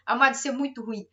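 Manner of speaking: ordinary delivery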